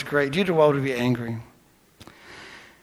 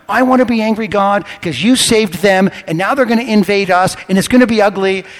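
loud, raised-pitch mock tantrum; little tantrum; 3 semitones higher, 6 dB louder than usual. Jonah protesting to God about saved enemies, having a tantrum. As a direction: complain loudly like a sulking prophet. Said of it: "he's having a little tantrum".

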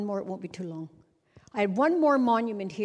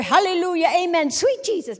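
congregation shout; 9 semitones higher, 7 dB louder than usual. Retold or imitated. Congregation responding to her singing.

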